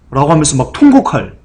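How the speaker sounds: usual speaking voice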